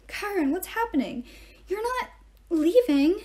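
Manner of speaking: high, upset questioning; distressed, high-pitched plea; shaky, worried voice. A narrator's reading. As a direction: ask anxiously, rising in pitch. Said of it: "Her voice was shaky".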